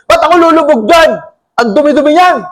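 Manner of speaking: mock outrage at the dirty river; angry Naaman. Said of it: "galit na galit eh".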